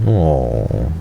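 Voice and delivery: normal talking voice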